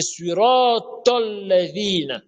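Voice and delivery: SURAT AL-LADHINA chanted slowly, t heavy as TOL; correct emphatic TOL. Shown as a demonstration. Teacher correcting the verse, slow chant with heavy tol. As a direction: draw out SURAT AL-LADHINA, rounding the link to tol. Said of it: "il y a le to et le ta".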